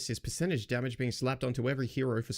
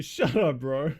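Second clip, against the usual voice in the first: louder laughing retort; laughing shut up; 3 semitones higher, 6 dB louder, slower than usual. Real, not acted. Streamer laughing back at the smug post.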